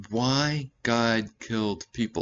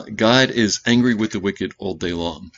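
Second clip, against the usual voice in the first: emphatic verse; 9 dB louder than usual. Acted. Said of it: "my favorite verses".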